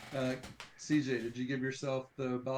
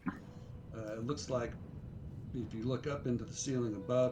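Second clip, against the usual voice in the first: unhurried dungeon description with lingering pauses; game master scene-setting; 2 semitones lower, 5 dB quieter than usual. A narrator's reading.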